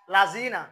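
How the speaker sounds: লাযিনা with a hard buzzing Z, "la-zeena", loud; wrong Z sound. Shown as a demonstration. Teacher shows the faulty reading, hard Z in লাযিনা. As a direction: hit a sharp Z in লাযিনা. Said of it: "এই লাযিনা এটা ভুল".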